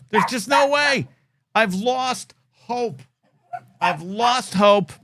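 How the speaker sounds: a touch of hammed-up despair, drawn out slowly; mock despair. Fan mourning his team's picks, slow mock despair. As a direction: sigh it out like a fan who gave up.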